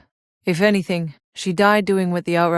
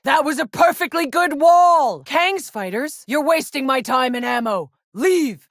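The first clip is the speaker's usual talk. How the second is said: angry shouting; 9 semitones higher than usual. Acted. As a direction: bark it out in fury. Combat, wall destroyed, ordering enemies off.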